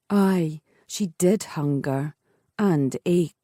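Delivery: ordinary delivery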